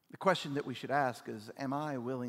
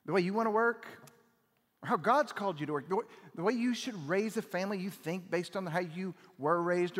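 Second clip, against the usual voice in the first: raised, urgent preaching, higher and louder; preaching at full force; 6 semitones higher, 5 dB louder than usual. Acted. Pastor urging the church to finally decide, fervent and loud. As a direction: drive it home like a fired-up preacher.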